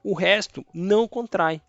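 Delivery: ordinary talk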